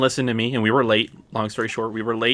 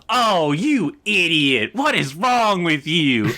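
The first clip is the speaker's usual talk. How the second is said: higher, slow mock exasperation at the screen; imitating girlfriend's outburst; 5 semitones higher, much slower than usual. Girlfriend scolding Star-Lord aloud in the theater, imitated with mock exasperation. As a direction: play the girlfriend groaning at a dumb hero, a bit hammed-up. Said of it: "And I just hear her go".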